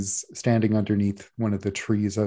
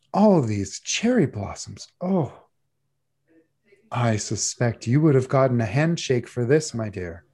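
slow, lightly voiced gracious judge; a hint of a doting baking judge; gushing judge. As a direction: savour each compliment like a kindly judge.

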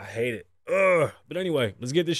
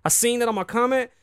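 ranting; 9 semitones higher, 5 dB louder, faster than usual. Real, not acted. Ranting against icks in comments.

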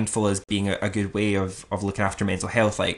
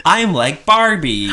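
high, loud, drawn-out playful brag; mock boasting; 8 semitones higher, 9 dB louder, slower than usual. Hailed as a multi-talent, playing along with mock boasting. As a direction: show off grandly, tongue in cheek. Playing up praise as triple threat.

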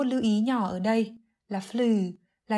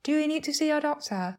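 lightly acted higher-pitched friend asking; concerned friend; 6 semitones higher than usual. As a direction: ask in a higher voice, as the other caller. Acted friend asking after health. (giọng người bạn hỏi thăm, cao hơn)

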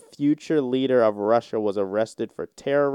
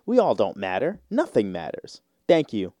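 ordinary talk, nothing marked

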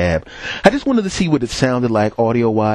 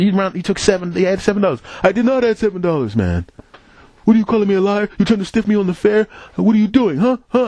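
angry cab driver; 10 semitones higher than usual. Retold or imitated. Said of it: "lower your voice".

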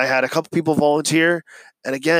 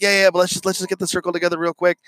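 higher, fast, keen to move on; hurried brushing off; 5 semitones higher, much faster than usual. Retold or imitated. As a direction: wave off the kids' objection, quickly and brightly. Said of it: "I'm like".

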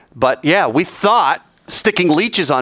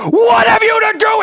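very high, loud shriek; shrieked, frantic challenge; demon's scream; 5 dB louder than usual. Acted. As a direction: scream it like the possessed man. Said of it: "it's such a contrast between".

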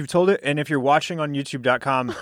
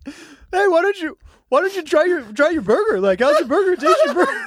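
high, sing-song teasing voice, much higher, laughing through it; mock dumb taunting; 12 semitones higher than usual. Friend taunting the drunk buddy about his taco, high goofy teasing voice. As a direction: goad him in a goofy high voice. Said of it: "Dumb and Dumbered you".

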